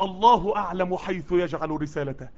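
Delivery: slow, low and soft verse; hushed Quranic quote; solemn recitation. Acted. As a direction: let the verse settle reverently.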